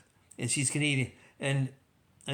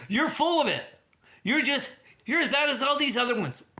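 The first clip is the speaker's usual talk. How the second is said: higher, mildly indignant scolding; mock scolding; 9 semitones higher than usual. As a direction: tell him off in a raised pitch. Voters scolding the premier.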